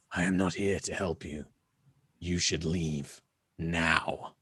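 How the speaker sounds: lightly voiced rasping elf. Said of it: "in a kind of rasping voice".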